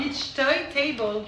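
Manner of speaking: "Beach toy table" drawn out very slowly; odd English pronunciation. Real, not acted. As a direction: say "Beach toy table" with each word stretched. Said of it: "tu le dis trop bizarrement". (« Beach toy table » prononcé bizarrement, très lentement)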